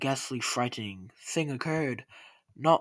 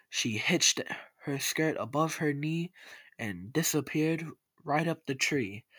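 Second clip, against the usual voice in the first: plain reading voice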